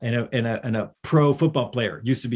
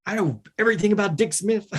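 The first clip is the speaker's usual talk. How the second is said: geeky fanboy; 8 semitones higher than usual. Retold or imitated. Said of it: "like, a total geeky fanboy".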